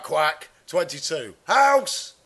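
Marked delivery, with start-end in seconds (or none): 1.4-2.1 high, sudden call of a win; mock bingo shout. Bingo winner calling out.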